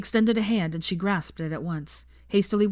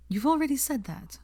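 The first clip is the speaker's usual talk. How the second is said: higher, lightly voiced young woman; a hint of a tart Hermione; lightly voiced exasperated girl; 8 semitones higher than usual.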